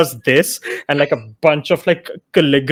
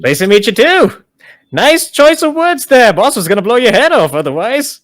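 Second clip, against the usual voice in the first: high chirpy skeleton voice; a cheery undead mage; lich voice; 6 semitones higher, 4 dB louder than usual. Acted. Lich Rattles talking.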